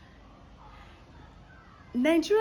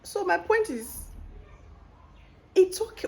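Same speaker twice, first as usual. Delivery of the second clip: excited rising; 11 semitones higher, 5 dB louder than usual. Real, not acted.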